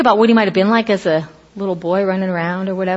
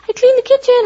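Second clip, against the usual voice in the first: much higher, brighter voicing of the daughter; daughter's text; 4 dB louder than usual. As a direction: read it like a cheerful teen. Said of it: "I'll get a text".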